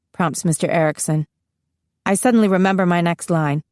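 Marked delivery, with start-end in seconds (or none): none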